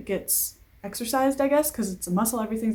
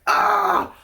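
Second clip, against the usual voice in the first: frustrated yell; 11 semitones higher, 4 dB louder than usual. Real, not acted. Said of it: "couldn't freaking breathe".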